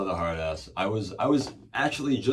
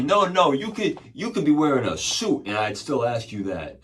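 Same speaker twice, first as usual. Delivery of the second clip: loud, raised-pitch imitation of an officer's retort; irate cop; 6 semitones higher, 7 dB louder than usual. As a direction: shut him down as a mock angry cop. Cop snaps back in retold story.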